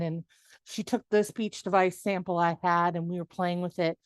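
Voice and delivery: ordinary talk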